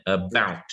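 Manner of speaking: "about" as a-BOUT, weak first sound; stress on second syllable. Shown as a demonstration. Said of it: "we're stressing our second syllable".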